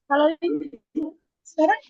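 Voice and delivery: clipping distorted mic; breaking up mic. Real, not acted. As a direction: voice cracking up over the line. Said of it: "Pecah suaranya katanya".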